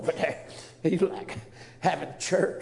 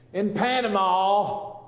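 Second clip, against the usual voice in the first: Panama drawn out slowly and louder; slow weighty In Panama; slow emphatic Panama; 3 semitones higher, 6 dB louder, slower than usual. Real, not acted. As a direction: lean hard on Panama. Preacher drives the point home.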